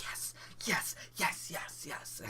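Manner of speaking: high, hissed whisper of triumph; breathless whisper-cheer, acted out; whispered celebration. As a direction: celebrate hard without being overheard. Secret celebration around the corner.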